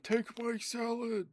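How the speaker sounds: very high, slow, stretched-out yell; in-joke yell. Retold or imitated. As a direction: belt the catchphrase out dramatically. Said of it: "this in-joke that we're like".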